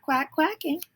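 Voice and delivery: a mild high quack sound on the word. A narrator's reading. Ducks still quacking at the cars, lightly imitated quack.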